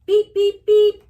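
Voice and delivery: high loud electronic beeps; beeping alarm. Acted. Alarm sounding in the kitchen, loud beeps. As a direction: imitate a shrill alarm going off.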